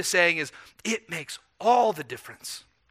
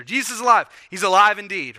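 raised, louder joyful call of the Easter greeting; Easter proclamation; 2 semitones higher, 7 dB louder than usual. Retold or imitated. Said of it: "We said it on Easter".

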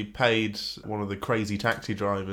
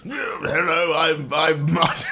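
lightly voiced old man, laughing; a hint of a creaky old man; 5 semitones higher, 4 dB louder, slower than usual. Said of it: "my old man voice".

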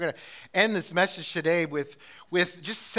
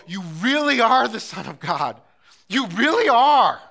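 loud, higher, slowing proclamation of faith; loud exclamation; 5 semitones higher, 12 dB louder than usual. Acted. Believers crying out loud to God in wonder. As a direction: proclaim it like a shout of worship.

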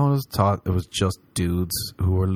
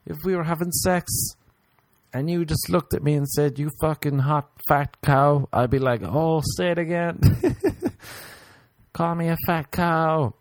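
put-on lightly higher girl voice; imitated girl; 8 semitones higher than usual. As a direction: imitate a keen admirer, voice lifted. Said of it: "A girl told me recently".

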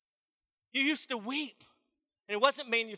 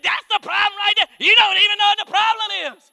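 shrieking high, loud tirade at her husband; wife going off; 9 semitones higher, 12 dB louder than usual. Retold or imitated. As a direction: scold him in front of the pastor, far higher and louder. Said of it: "she's going off like that".

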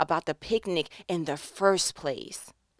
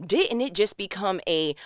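ordinary talk, nothing marked

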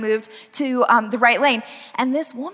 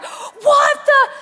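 much higher, loud furious shout; angry shout; 13 semitones higher, 5 dB louder than usual. Retold or imitated. Angry driver cursing at her, loud shout. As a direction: yell it like road rage, cut off. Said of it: "And she's like".

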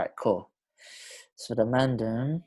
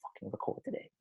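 quiet inner voice; 18 dB quieter than usual. Retold or imitated. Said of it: "sometimes I'm thinking".

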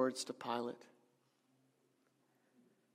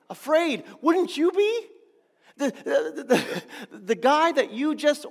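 loud and much higher, incredulous; excited exclamation; 9 semitones higher, 8 dB louder than usual. Real, not acted. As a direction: burst out with the answer. Preacher answers his own question.

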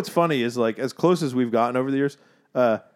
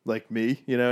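ordinary talk, nothing marked

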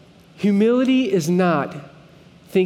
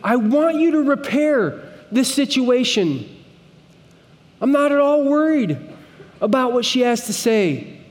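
high, pressing plea with a raised voice; begging plea; 6 semitones higher than usual. Retold or imitated. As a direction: plead as if begging God to fix it. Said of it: "pleading with God in a begging sort of way".

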